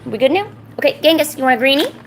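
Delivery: high coaxing pet voice; talking to a dog. Real, not acted. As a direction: coax a pet. Offering treat to pet.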